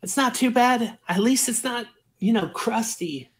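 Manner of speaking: put-on eager young Raz. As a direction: sound like a thrilled kid.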